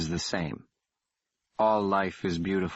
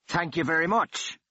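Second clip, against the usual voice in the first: mocking call down the corridor; 8 semitones higher than usual. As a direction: call it out as he backs away. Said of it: "the man called".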